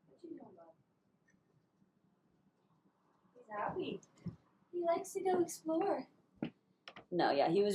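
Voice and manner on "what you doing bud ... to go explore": much higher, pet-talk voice from across the room; cooing to the cat. Real, not acted. Talking to her cat.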